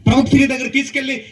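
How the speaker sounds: usual speaking voice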